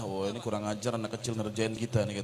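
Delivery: low, grumbling annoyance, lightly voiced; annoyed villagers. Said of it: "orang kampung marah". (warga kampung menggerutu kesal, suara lebih rendah)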